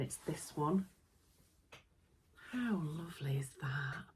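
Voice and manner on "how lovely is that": whispered, softer, admiring the fabric; hushed admiration. Real, not acted. Showing new fabric to viewers, whispered admiration. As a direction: breathe it out in awe over the cloth.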